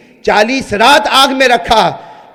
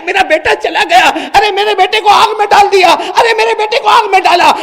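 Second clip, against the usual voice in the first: loud, high wailing cry; mother cries out; 10 semitones higher, 5 dB louder than usual. Acted. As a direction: scream out in grief. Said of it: "وہ ماں زور سے چلائی". (زور سے چلاتی ماں)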